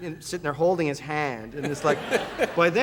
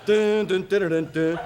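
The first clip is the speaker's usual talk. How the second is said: ominous hummed sting; 3 semitones higher, 3 dB louder than usual. Retold or imitated. Ominous ambush sound effect.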